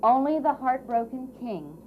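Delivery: ordinary delivery, nothing marked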